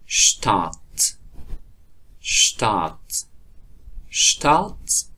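شتات with sh-t onset, final t crisp; st said as sht. Shown as a demonstration. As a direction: say شتات as shtat, slow.

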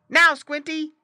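high, loud signal to a pet; calling out. A narrator's reading. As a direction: call the cue out high. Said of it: "until the boy called".

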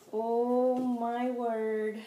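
very slow, drawn-out astonishment; slow awestruck exclamation; stunned amazement. Real, not acted. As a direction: stretch each word in delighted disbelief. Stunned by the gift.

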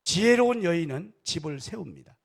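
loud proclamation. Acted. Pastor declaring a proverb to the church, loud.

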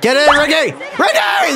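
high, urgent shout at a player; real panicked calling out; frantic yell. Real, not acted. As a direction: yell the name, voice leaping up. Yelling at fielder during play.

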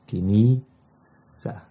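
كنيسة with a soft S from the front teeth, said slowly. Shown as a demonstration. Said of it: "the S here is soft".

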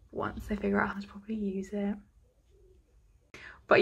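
whisper. Real, not acted. Sheepish aside about using stethoscope.

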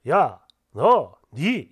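slow, low, drawn-out careless address; lightly voiced boyfriend talking down; imitating husband. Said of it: "이렇게 하더라고요". (낮고 느리게 끄는 남편 흉내)

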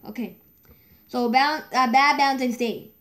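usual speaking voice

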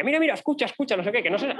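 high excited laughing call; laughing sister. Retold or imitated. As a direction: giggle while urging him to listen. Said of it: "a mi hermano de doce años le decía". (hermana riendo pasa el fonendo al hermano, voz aguda)

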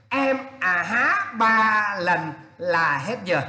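slow, stretched à há; slowly, stressing à há; slow repeat. Shown as a demonstration. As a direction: drag it out to stress.

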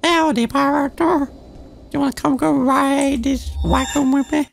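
very high-pitched mock Southern country girl; hammed-up American South accent, way up high; butchered southern belle accent in falsetto. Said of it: "one of them southern, um, country girl accents".

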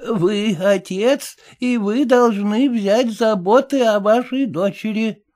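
put-on high, slow elderly lady voice; a frail old woman explaining patiently; lightly aged lady, unhurried. A narrator's reading.

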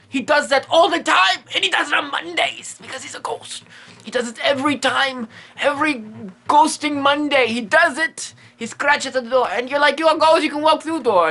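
high-pitched, worked-up complaint; mock exasperated rant. Mock rant about annoying ghost dogs.

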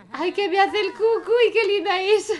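high, loud delighted squeal; bursting with adoration; gushing. Acted. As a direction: coo excitedly over a cute baby. Gushing over a cute baby.

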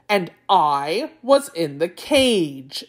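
"I was in the cage" slow and emphatic; laboured explanation. Acted. Tiger spelling it out for the dim jackal, slow and emphatic.

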